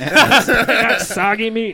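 slow, loud, laughing through it. Real, not acted. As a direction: say it while cracking up. Laughing about the spilled beer.

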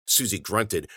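plain reading voice

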